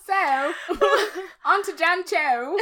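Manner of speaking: high, loud fake Cockney through laughter; bad Cockney. Host dared to continue the podcast in Cockney, laughing bad Cockney. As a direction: attempt Cockney while cracking up. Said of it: "podcast in a Cockney accent".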